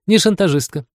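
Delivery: put-on high-pitched, stern little girl; stern correction. A narrator's reading. Лиза corrects Джек's word for her, high and strict. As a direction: correct him strictly in a child's voice. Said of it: "строго поправила его Лиза".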